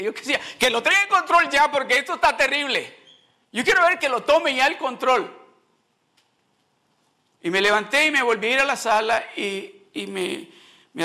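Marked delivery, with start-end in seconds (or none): 0.0-5.3 high, loud exasperation; loud retort, pitched well up; exasperated outburst. Retold or imitated. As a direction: burst out impatiently at the end of your rope. Acted outburst at pious reassurance.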